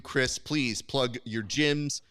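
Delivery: ordinary talk, nothing marked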